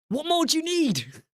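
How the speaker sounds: high-pitched, incredulous plea; exasperated. Real, not acted. Streamer finishing rant at Subway customers, exasperated. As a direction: wail the question up high in disbelief.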